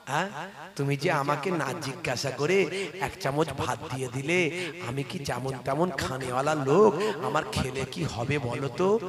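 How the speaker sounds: a hint of a kindly elder questioning a boy; gentle old cleric. Cleric gently questions the boy, lightly voiced.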